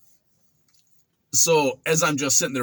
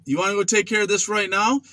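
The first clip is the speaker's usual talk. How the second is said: high, raised challenge; angry inmate; 8 semitones higher than usual. Retold or imitated. Mopper threatening the young smart ass, angry. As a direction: throw back the insult, pitched up. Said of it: "He's like".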